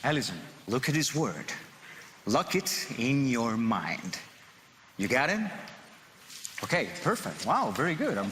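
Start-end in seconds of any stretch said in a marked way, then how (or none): 2.3-4.2 slow, hypnotic command; slow deliberate instruction. Acted. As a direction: draw each word out. Magician instructs volunteer slowly.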